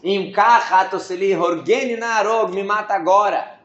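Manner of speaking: teacher stressing the Hebrew plea, slow and heavy; heavy emphasis. Shown as a demonstration. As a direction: lean hard on every word of the plea. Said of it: "fica muito pesado no hebraico".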